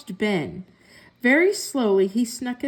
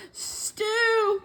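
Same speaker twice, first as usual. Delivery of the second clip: much higher thrilled cry; excited cat; 11 semitones higher than usual. A narrator's reading. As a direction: leap up at the word stew. Cat hears magic word.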